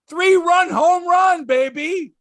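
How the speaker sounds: high, slow, gloating home run call; mock play-by-play celebrating the homer; triumphant. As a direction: stretch it out like a victory shout. Gloating over Muncy's homer.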